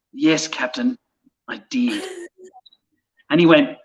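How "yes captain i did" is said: sheepish admission. Retold or imitated. To the captain, a meek, soft confession. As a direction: lower and much softer, ashamed.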